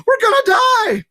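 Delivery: very high, loud panicked scream; panicked yelling. A narrator's reading. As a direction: passengers screaming in terror.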